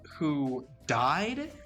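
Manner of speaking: ordinary talk, nothing marked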